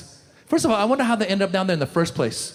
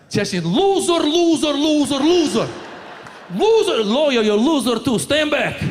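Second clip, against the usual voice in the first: angry uncle; 6 semitones higher than usual. Retold or imitated. Chechen uncle at the press conference: furious repeated yell. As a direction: spit the insults at everyone.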